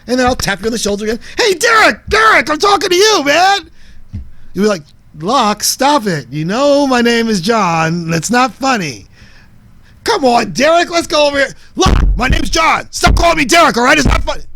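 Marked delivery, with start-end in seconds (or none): 1.4-3.7 very high, loud pestering call; insistent shouting. Acted. Acted insistent calling after being ignored. 5.2-9.1 slow, raised-pitch telling-off; firm annoyance. Acted. Acted annoyed reply to pestering. 10.0-11.5 high, pushy urging; pestering call. Acted. Acted pestering of annoyed man. 11.8-14.4 high, loud exasperated outburst; acted yell at a pest to stop; angry shouting. Acted quarrel over wrong name.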